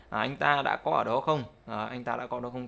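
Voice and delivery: ordinary talk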